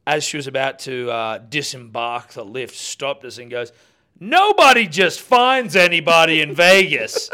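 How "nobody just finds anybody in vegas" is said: loud, high, drawn-out holler; imitated scream. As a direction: holler it slowly like the woman in the lift. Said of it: "She screamed it at us".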